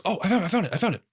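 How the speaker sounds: high, eager "I found it"; excited Ethan. Retold or imitated. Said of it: "he goes".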